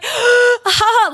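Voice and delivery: loud, high-pitched forced laugh; overdone pretend laughter; fake polite laugh. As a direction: laugh along as if you understood. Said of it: "pretend you know what they said".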